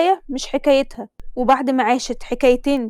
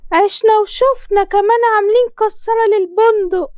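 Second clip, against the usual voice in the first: put-on higher, louder gushing older woman; groom's mother blessing; 8 semitones higher, 4 dB louder than usual. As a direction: lay the blessing on thick, pitched up. (حماة بتبارك بصوت عالي ومبالغ)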